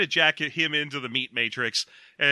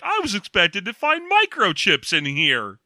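slow, higher, fake disappointed; mock naive; 5 semitones higher than usual. Someone mocking a man surprised to find meat in a hamburger, naive. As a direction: say it like a clueless diner. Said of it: "being like".

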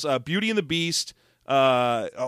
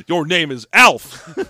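slow, louder commanding line; mock stern naming; 8 dB louder, slower than usual. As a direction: declare it like a master. Mock slave-owner line.

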